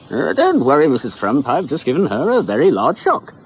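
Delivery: lightly voiced eccentric doctor; hammed-up doctor. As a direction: play the breezy mad doctor. Doctor voiced reassuring the mother.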